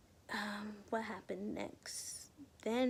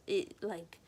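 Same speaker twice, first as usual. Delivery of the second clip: ordinary talk, nothing marked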